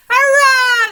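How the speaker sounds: high, loud hurrah; cheering shout. Acted. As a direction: cheer like a crowd of birds. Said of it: "they shouted".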